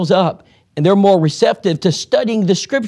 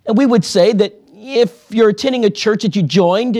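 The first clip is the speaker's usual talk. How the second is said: usual speaking voice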